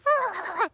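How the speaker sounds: a high, loud, cut-off cry of frustration; frustrated yell. Retold or imitated. After falling on the trail, a sharp high frustrated yell. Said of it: "with explicit terms, of course, and I was like".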